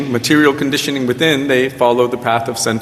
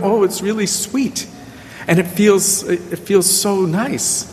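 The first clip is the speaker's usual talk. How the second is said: mock dreamy gushing; 6 semitones higher than usual. Mocking devotee's sentimental answer.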